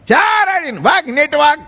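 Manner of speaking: loud high shout of the punchline. Acted. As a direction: belt it out to the crowd.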